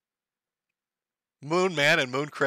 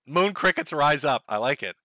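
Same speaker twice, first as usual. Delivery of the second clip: ordinary talk, nothing marked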